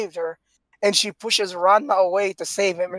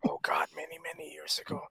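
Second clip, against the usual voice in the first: breathy aside whisper under the breath; 4 semitones lower, 13 dB quieter than usual. Real, not acted. Remembering the old channel, a whispered aside.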